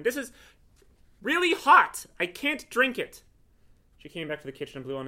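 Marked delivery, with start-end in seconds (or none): 0.0-3.2 high, loud, drawn-out wail of protest; yelled complaint. A narrator's reading. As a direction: yell it as an indignant complaint. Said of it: "yelled Glenn".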